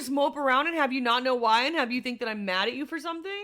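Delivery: fast, high, loud retort opening; rapid-fire exasperated comeback, easing after the first question; defensive burst. Real, not acted. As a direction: rattle it off in protest. Defends herself to boyfriend.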